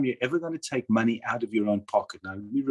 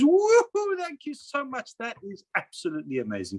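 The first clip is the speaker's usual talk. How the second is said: excited woohoo; 12 semitones higher than usual. Retold or imitated. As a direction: whoop like a delighted recipient, then melt into gratitude. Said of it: "She does the woohoo bit much better than me".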